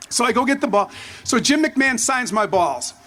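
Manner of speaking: usual speaking voice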